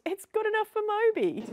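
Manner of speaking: high-pitched sarcastic punchline; exaggerated, jokey endorsement; mock justification.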